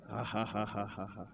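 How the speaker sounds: soft, low faith laugh; quiet mock laughter at the enemy; a demonstration laugh, held back; demonstrated laugh. Shown as a demonstration. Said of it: "Just try to laugh".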